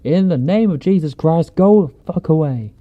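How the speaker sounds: loud, high, wavering holler; retold commanding shout. Cousin with rifle ordering the thing in the woods away, shouting. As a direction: holler it like banishing a demon. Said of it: "says something to the effect of".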